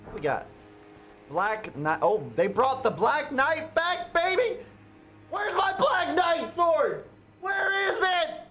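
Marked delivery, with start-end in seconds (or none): 2.0-8.4 rising to a high, loud excited shout. Real, not acted. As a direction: cheer it out, thrilled, voice up high. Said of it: "I'm excited now".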